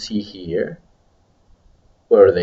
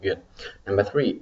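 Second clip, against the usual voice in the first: ordinary talk, nothing marked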